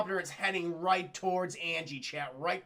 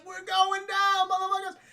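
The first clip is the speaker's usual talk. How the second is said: crashing pilot's shout; 11 semitones higher, 5 dB louder than usual. Retold or imitated. Pilot as the helicopter crashes, hammed-up yell. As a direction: holler it long, hammed up.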